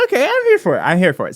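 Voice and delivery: ordinary delivery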